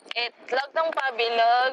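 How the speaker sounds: drawn out, word by word; slowly spelling out the phrase; slow and careful. Shown as a demonstration. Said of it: "ay, wait lang".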